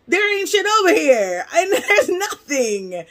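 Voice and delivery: loud, high-pitched exasperated outburst; comic exasperated cry; retold exasperation. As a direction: burst out high and loud, then stretch it out. Retold exasperated answer on phone.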